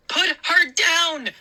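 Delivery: slow, high, loud command; breathless shout. A narrator's reading. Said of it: "I shouted breathlessly".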